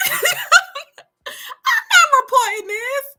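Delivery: high, slow laughing refusal. Real, not acted. Mother refusing to report the clip, laughing. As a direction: laugh it out in disbelief.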